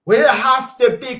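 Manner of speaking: shouted emphasis; emphatic shout. Real, not acted. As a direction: drive it home with a shout. Repeated louder for emphasis.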